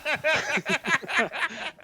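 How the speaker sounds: laughing. Real, not acted. Reader laughs before reading.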